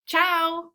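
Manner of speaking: one-beat "Ciao" for the class; one clapped syllable. Shown as a demonstration. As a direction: clap "Ciao" once, short and bright. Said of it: "Can you do ciao?".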